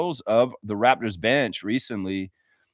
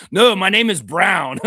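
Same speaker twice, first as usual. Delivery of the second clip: louder and slow, mock haughty insistence; hammed-up huffy correction; 5 semitones higher, 8 dB louder than usual. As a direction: correct them like a stuck-up snob. Mocking the player's reply.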